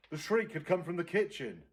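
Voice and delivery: lively read-aloud narration; story narration. A narrator's reading. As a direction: read it to the class with suspense. Teacher reads story aloud.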